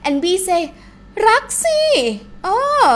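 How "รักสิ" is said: รักสิ said much higher, insisting; emphatic รักสิ. Shown as a demonstration. Partner B answering whether she loves him, emphatic. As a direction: leap up on รักสิ to insist on love. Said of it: "I emphasize I do".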